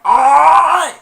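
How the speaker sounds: high, loud yelp of shock; startled scream. Retold or imitated. Principal caught on camera, high loud scream. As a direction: scream like you got caught. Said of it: "Principal's like".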